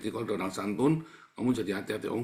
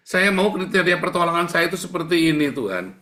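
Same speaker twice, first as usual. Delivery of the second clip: louder, higher insistent demand to God; demanding believer; 5 semitones higher, 8 dB louder than usual. Retold or imitated. Believer in the wilderness demanding God's help, loud and insistent. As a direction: demand help on your own terms. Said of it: "memilih pertolonganmu sendiri".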